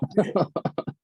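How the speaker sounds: short rueful laugh. Real, not acted. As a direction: laugh at your own loss. Laughing at lost money.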